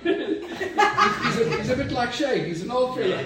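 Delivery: giggling through the words; can't keep a straight face; laughing. Real, not acted. Pastor teasing about Shay, laughing.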